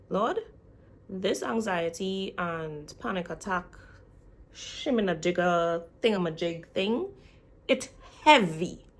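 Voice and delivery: slow, loud, emphatic plea; crying out. Retold or imitated. Crying out to the Lord about anxiety, loud plea. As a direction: cry out to the Lord, drawing out the words. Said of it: "crying out".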